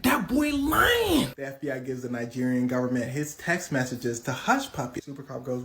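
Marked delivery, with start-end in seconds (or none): 0.0-1.3 high, very drawn-out protest; indignant denial. Retold or imitated. Super Cop denies the accusation, mock indignant. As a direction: stretch the denial out, pitched up.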